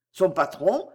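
plain reading voice

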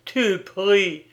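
scornful exclamation; crushing contempt. A narrator's reading. Young man scorns the girl for praying, contemptuous. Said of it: "avec un mépris écrasant".

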